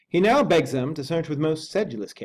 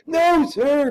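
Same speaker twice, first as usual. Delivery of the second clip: much higher, louder cry of protest; a sharp higher outcry; cried out; 8 dB louder than usual. Acted. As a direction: cry out against him as the butler. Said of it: "cried Poole".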